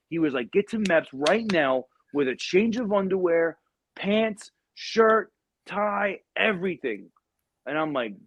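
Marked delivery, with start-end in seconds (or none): 0.5-7.1 high-pitched retold order, item by item; urgent orders. Gunny orders assistant to bring clothes, urgent orders. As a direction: fire off the list like an impatient gunny.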